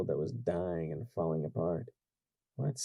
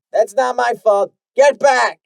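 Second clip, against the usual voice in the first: loud higher shout to back off; angry shout; 16 dB louder than usual. Acted. Guard shouting the crowd back, angry. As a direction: shove them back with your voice.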